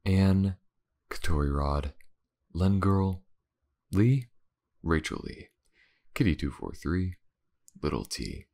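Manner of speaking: normal talking voice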